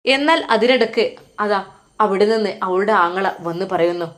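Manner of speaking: ordinary delivery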